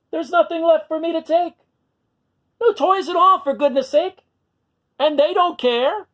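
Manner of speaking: high, sulky little dinosaur voice, lonely; a small lonely monster complaining; sad little dinosaur. Acted. Minosaur laments his lost toys.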